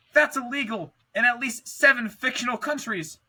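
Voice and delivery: high, shocked gasping line; gasped. A narrator's reading. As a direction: gasp it in shock. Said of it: "Peely gasped".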